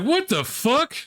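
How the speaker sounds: high incredulous yelp; shocked reaction. Retold or imitated. Streamer reacts to the rude remark with a high yelp. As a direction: squeal it in disbelief. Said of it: "I was like".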